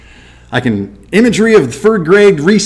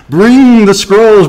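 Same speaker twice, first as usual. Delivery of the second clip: laughing repeat; 4 semitones higher, 6 dB louder, much slower than usual. Retold or imitated. Repeats Paul's request, laughing.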